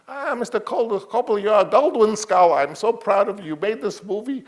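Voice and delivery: put-on high, proud old mogul voice; Sam Goldwyn praising. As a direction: gush over the young director in a high fussy voice. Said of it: "he talked like I'm gonna talk".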